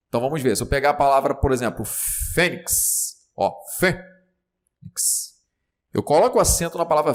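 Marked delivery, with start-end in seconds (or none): 1.9-5.4 "fênix" stretched, with FÊ set apart from nix; sílaba forte destacada. Shown as a demonstration. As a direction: draw out "fênix" syllable by syllable. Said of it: "na sílaba mais forte". ("fênix" devagar, destacando o fê)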